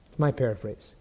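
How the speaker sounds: usual speaking voice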